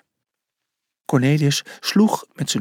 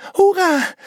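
ordinary delivery

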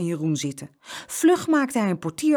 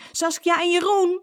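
put-on high, loud call for the children; 13 semitones higher, 6 dB louder than usual. A narrator's reading. Father searching for his twins, calling out. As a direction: call both names out down the street. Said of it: "riep hij".

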